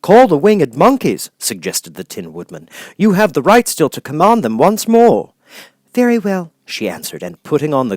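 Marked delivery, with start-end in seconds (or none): 0.0-5.3 louder, higher helpful suggestion; lightly voiced Tin Woodman. Tin Woodman advising Dorothy, louder eager voice. 5.9-6.5 put-on high, worried child voice; girl voice. Dorothy asking friends what to do, high worried girl.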